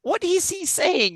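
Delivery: high, slow, puzzled mock voice; puzzled player. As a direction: drag out the confused question. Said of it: "one play".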